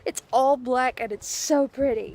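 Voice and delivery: usual speaking voice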